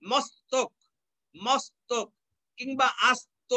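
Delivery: মস্তক, আস্ত split after a clear "s"; mos-tok, as-to with English s; dental s sound. Shown as a demonstration. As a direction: hold the s before the t in মস্তক. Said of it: "দন্ত স এর correct উচ্চারণ পাওয়া যাবে".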